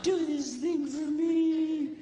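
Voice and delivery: slow, sugary coaxing, half laughing; coaxing wife. Retold or imitated. Wife pats his cheeks and slowly sweet-talks him. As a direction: sweet-talk him into it, drawn out.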